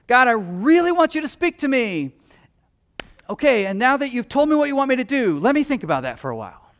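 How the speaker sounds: high, loud mock-eager prayer that trails off; reluctant believer. Preacher mimics a believer stalling God, high and loud. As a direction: play the half-hearted believer, eager then backing off. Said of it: "we act like this".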